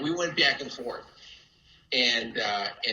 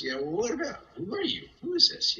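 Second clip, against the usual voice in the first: questions climbing sharply higher; retold suspicious challenge; 11 semitones higher than usual. Prank victim questioning an unknown caller, suspicious. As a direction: imitate a baffled man demanding answers.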